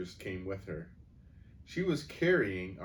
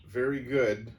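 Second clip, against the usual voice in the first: plain reading voice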